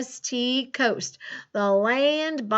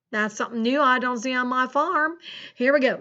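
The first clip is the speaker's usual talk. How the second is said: put-on folksy rural American farmer accent; Farmer Ted's country accent. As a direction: chat to the kids in a hammed-up country farmer accent. Plays Farmer Ted throughout lesson.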